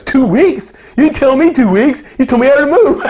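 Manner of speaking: mock shock that breaks into a laugh; James's disbelief. Friend hearing the move news, incredulous and laughing. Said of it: "And James goes".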